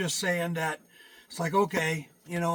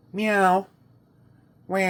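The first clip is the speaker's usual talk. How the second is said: human doing cat meows; imitated meow; 3 semitones higher, 6 dB louder than usual. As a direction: meow at the cat to get an answer. Imitates cat to make it talk.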